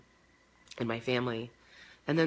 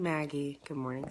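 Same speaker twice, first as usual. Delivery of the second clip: normal talking voice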